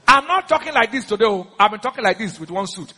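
ordinary talk, nothing marked

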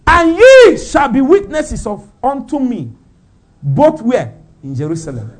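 fervent scripture reading; preaching scripture. Acted. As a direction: declaim it from the pulpit.

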